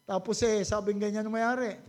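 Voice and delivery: normal talking voice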